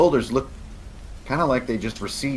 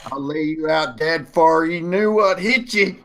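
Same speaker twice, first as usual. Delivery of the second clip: high holler, climbing as it goes; yelling hostile stranger; 6 semitones higher than usual. Acted. Unseen stranger threatens to lay them out, yelled threat. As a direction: let the threat rise into a holler. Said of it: "as he yells".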